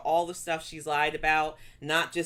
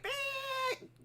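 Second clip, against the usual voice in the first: mock beep; 11 dB quieter than usual. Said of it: "I would have loved to just been like".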